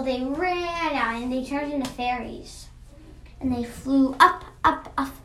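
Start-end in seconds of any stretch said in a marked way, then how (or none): none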